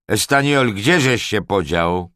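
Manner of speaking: loud, high impatient call; gniewne wołanie. A narrator's reading. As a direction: holler for a missing underling. Angry lord calls missing groom. (głośne, zniecierpliwione wołanie)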